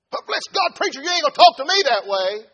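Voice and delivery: loud, high outburst; indignant objection. Acted. Offended churchgoer to the preacher, indignant shout. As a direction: shout back in offence at being spoken to so.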